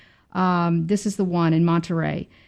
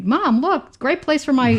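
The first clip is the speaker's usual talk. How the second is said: lightly voiced excited kid, pitched higher; a kid tugging at mom's sleeve, higher voice; child's voice; 6 semitones higher than usual. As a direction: kid showing mom the yard, voice raised in pitch. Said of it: "someone once say".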